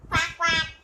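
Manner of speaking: loud playful quacks like a duck; duck quacking. Retold or imitated. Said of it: "what is the duck saying?".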